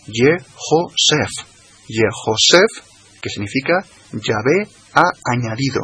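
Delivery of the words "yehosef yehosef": Yehosef drawn out slowly, Ye-ho-sef; slow Hebrew pronunciation. Shown as a demonstration. Teacher slowly showing listeners how José is really said. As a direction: spell out Yehosef syllable by syllable. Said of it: "realmente se pronuncia".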